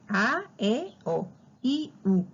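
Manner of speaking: A, E, O, then I, U, each sounded separately; teacher isolating the vowels A E O, I U; showing open and closed vowels. Shown as a demonstration. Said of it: "la misma boca me lo dice".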